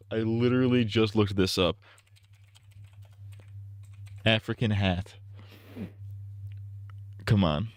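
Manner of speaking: ordinary talk, nothing marked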